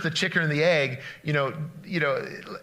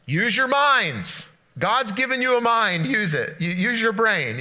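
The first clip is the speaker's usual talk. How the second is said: higher, louder, slow insistent reminder; emphatic urging; 5 semitones higher, 3 dB louder than usual. Retold or imitated. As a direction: press it home like a mentor drilling a lesson. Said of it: "she would always tell us".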